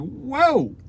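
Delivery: imitating a high startled whoa that settles down; mock surprise. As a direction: act out the viewer catching on. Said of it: "You're gonna be like".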